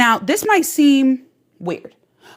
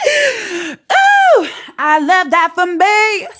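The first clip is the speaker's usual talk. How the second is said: high loud gleeful Ooh; giddy high squeal then slow savoring; delighted squeal; 7 semitones higher, 5 dB louder, much slower than usual. Real, not acted. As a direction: squeal with self-satisfied delight. Delighted squeal after laughing.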